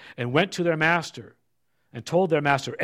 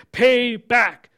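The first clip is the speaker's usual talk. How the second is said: sharp, louder, higher demand for the debt; 5 semitones higher, 6 dB louder than usual. A narrator's reading. Servant choking his fellow, demanding loudly. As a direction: bark it at the debtor, louder and higher. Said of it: "he demanded".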